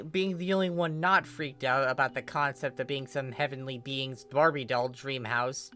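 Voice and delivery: usual speaking voice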